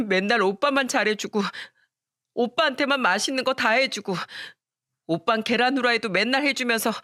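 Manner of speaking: put-on high, pleading child's complaint; tearful protest. Daughter protesting mother's favoritism toward her brother, tearful. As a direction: push back against mom through hurt.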